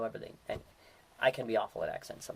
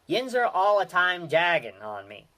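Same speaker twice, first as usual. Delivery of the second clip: "Yins are all the time jagging on me" in a louder, higher Yinzer accent; Pittsburgh accent example; 4 semitones higher, 6 dB louder than usual. Shown as a demonstration. Host demonstrating a Pittsburgh sentence, in a Yinzer accent. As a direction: toss off the line like a local Pittsburgh Yinzer. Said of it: "used in sentence".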